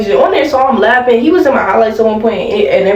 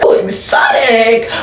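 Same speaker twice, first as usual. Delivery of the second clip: mock delight; 3 semitones higher, slower than usual. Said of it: "mock excitement".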